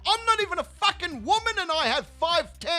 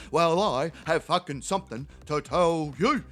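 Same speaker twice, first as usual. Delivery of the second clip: imitating Terry; 9 semitones lower than usual.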